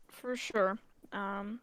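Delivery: eating while talking; talking through a bite of food; mouth full. Real, not acted. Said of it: "sorry, I was just eating up".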